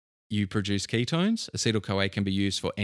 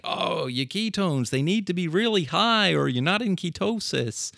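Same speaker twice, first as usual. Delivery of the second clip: bad American accent; 9 semitones higher than usual. Mimicking podcasts about ketone levels, in a bad American accent. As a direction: put on an exaggerated, high-pitched American accent. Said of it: "my bad American accent".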